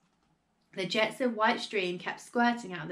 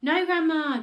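high, loud objection; raised voice, cutting in; anxious protest; 8 semitones higher, 7 dB louder than usual. Acted. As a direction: blurt out a worried child's protest. George protests to furious Grandma.

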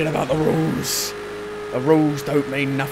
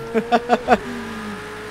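high giggling laugh; mischievous laugh; 7 semitones higher than usual. Real, not acted. Player laughing at skipping the pit lane, high laugh.

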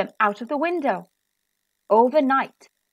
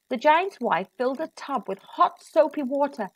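plain reading voice

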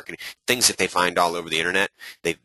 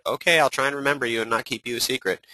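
pitched-up mock answer; mock reply; 6 semitones higher than usual. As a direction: play the polite brushed-off friend. Voicing a brushed-off contact.

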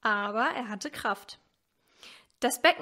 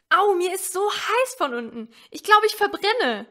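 put-on high, loud complaining child's voice; a child stuck and miserable, complaining loudly; little girl Elsie; 8 semitones higher, 7 dB louder than usual. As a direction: whine it out like a freezing little girl. Elsie's voice in the story.

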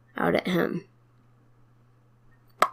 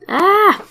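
startled yelp; 12 semitones higher, 4 dB louder than usual. Pretend. Startled by attacker.

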